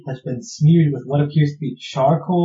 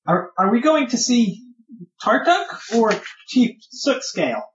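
higher little creature voice; a higher kobold guard asking; 7 semitones higher than usual. Acted. Kobold guard asks the party.

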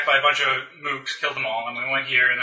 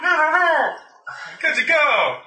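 Nicolas Cage impression; 14 semitones higher, much slower than usual. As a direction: go way up and frantic like Nic Cage. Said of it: "And then Nicolas Cage".

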